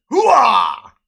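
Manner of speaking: loud Al Pacino hoo-ah, high; gung-ho soldier. Retold or imitated. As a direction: bark it like a fired-up soldier. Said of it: "I added a hoo-ah".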